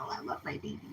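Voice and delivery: soft whispered love for the dogs; whispered coo. Real, not acted. Owner cooing to her dogs, whispered. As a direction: whisper it tenderly to the pups.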